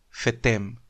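"Fetén" said as fetem, lips closing; low "Fetén" with a humming m at the end; final M. Real, not acted. Co-host after spelling it, "Fetén" ending in M. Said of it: "Dijiste fetén y pegaste los labios".

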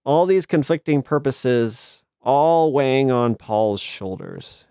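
usual speaking voice

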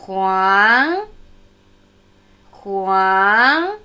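"Huang" said slowly with its pitch climbing at the end; teacher demonstrating the rising tone of "Huang"; rising second tone. Shown as a demonstration. Teacher drills "Huang" with a clear rising second tone. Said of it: "Zweiter ansteigende Ton".

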